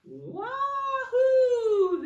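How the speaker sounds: very high, loud gleeful yell; yelled wahoo. Acted. Snowmen sledding down the hill, shouting with joy. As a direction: shout it like a snowman flying downhill. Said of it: "they yell".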